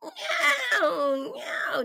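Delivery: high drawn-out meow; caterwauling cat. Retold or imitated. Wife mimicking the noise she heard at night, caterwauling. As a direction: imitate a cat's yowl. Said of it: "then I hear this cowling".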